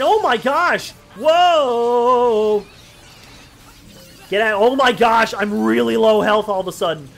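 loud startled gamer panic; panicked exclaiming. Real, not acted. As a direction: yell it out as you get hammered. Getting annihilated in the game.

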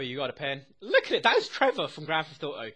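ordinary talk, nothing marked

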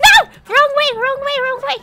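loud high frantic warning; frantic shouting. Real, not acted. Frantic chase, wrong direction.

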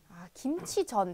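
ordinary delivery

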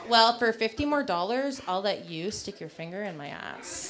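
sly quiet offer. Retold or imitated. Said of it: "she goes".

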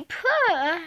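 ordinary talk, nothing marked

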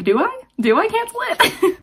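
high, giggly second-guessing; laughing self-doubt. Real, not acted. Reconsidering cancelling the subscription, high laughing question. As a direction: laugh at her own change of heart. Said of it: "and now I'm like".

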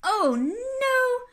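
loud, high, stretched wail; exasperated Mister Bear. Acted. Sleepless bear hears aeroplane noises, loud exasperated wail. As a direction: cry it out in dismay.

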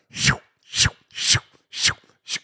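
quick, airy repeated shoo sounds; whispered whooshes, one after another; whooshing sound effect. Retold or imitated. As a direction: imitate things whizzing past with voiceless shoos. Imitating sudden rush of play.

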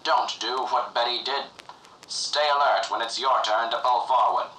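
ordinary talk

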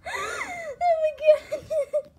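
high, loud, slow laughing cry; real burst of laughing disbelief; laughing shout. Real, not acted. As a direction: shriek it through laughter. Sees dad, bursts laughing.

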